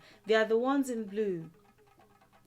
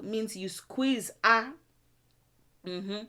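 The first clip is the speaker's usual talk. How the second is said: normal talking voice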